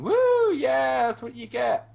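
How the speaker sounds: shrieking high, loud whoop that fades down; hammed-up gloating talk-show crowd; imitating a cackling audience, very high and loud; mock gleeful cheer. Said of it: "people fucking cackling and going".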